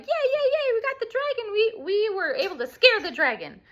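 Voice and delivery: very high, squealy victory cheer; excited cheering. Retold or imitated. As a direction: cheer like they just won. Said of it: "then they're like".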